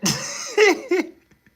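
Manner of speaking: loud, high-pitched burst of laughter. Real, not acted. Cracking up telling Molly the story. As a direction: howl with laughter.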